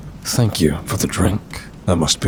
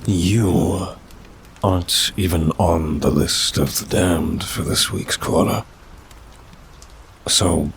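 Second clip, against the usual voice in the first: ordinary delivery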